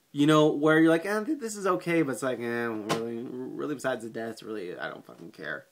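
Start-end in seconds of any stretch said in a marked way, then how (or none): 1.1-2.0 higher-pitched noncommittal "eh"; lukewarm verdict. Retold or imitated. Acting out a lukewarm viewer reaction. 2.4-5.6 dropping low and quiet, couldn't care less; mock indifference. Acting out an indifferent viewer reaction.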